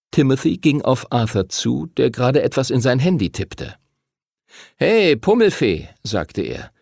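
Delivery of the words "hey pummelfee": a raised, jeering call; taunting bully. A narrator's reading. Timothy taunts Arthur, jeering. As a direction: taunt the chubby kid.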